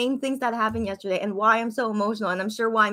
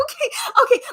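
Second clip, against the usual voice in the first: loud, high laughing okay; real delighted laugh; excited laugh; 13 semitones higher, 5 dB louder than usual. Real, not acted. Viewer ready for emotional journey.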